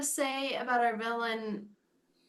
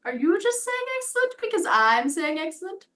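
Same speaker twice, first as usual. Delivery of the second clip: higher, louder imitation of a suspicious villain; Goob; 7 semitones higher, 5 dB louder than usual. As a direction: challenge the frog in mock indignation. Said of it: "and Goob says".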